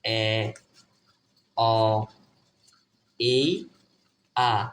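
এ, অ, এই, আ as four isolated vowel sounds, long pauses between; teacher slowly spacing out এ, অ, এই, আ; demonstrating letter sounds. Shown as a demonstration. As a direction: model each sound separately: এ, অ, এই, আ. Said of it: "এ এর উচ্চারণ চার রকম".

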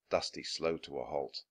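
usual speaking voice

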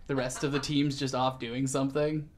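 ordinary talk

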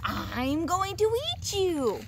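high, slow growly troll menace; growly troll threat. A narrator's reading. Troll threatens little goat on the bridge, slow high growl. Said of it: "in a growly voice".

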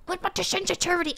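quick, hurried repeat; fast repeat. Real, not acted. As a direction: rattle it off fast. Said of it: "repeated faster".

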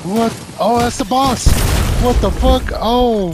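loud, high surprised yelling; real shock at the boss; startled yell. Real, not acted. Boss appears suddenly, yelling.

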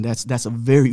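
usual speaking voice

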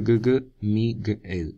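gue, gue, Miguel as mi... gu... el; sounded-out hard g. Shown as a demonstration. Teacher sounding out the G, Miguel split slowly as mi-gu-el. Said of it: "que es G".

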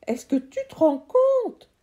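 high, loud, sharp cut-in; curt interruption. Acted. Uncle cutting the boy off, sharp and loud. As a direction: cut him off dryly. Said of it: "a-t-il coupé sèchement".

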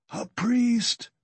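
very high, strained-for plea of the dying; dying man. A narrator's reading. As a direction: plead as a dying man, pitched far up. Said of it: "the dying man said again".

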